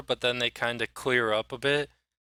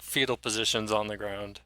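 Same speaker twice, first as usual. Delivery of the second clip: usual speaking voice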